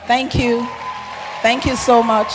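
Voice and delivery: high, very loud thanks to the crowd; loud heartfelt thanks. Real, not acted. Award winner thanking the pastors, loud and exultant. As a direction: call out your love and gratitude at full voice.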